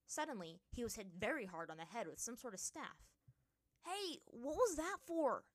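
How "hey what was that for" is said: high, loud, drawn-out protest; indignant yell. A narrator's reading. Aang protests a blow to his head, yelling. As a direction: yelp in outrage after being hit. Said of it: "the avatar yelled".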